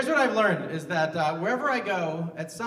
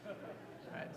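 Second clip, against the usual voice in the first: normal talking voice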